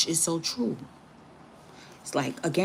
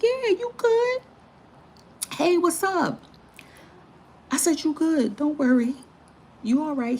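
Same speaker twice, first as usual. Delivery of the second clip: much higher, loud reassuring chirp; high friendly voice; 11 semitones higher, 4 dB louder than usual. Retold or imitated. Reassuring the man at the store, high and bright. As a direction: sing it up high to calm him. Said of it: "I was like".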